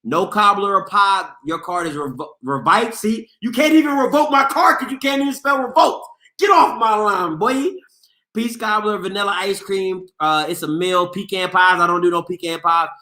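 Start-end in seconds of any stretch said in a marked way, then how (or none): 2.9-7.7 mocking shout. Real, not acted. Mocking a chatter's misspelling.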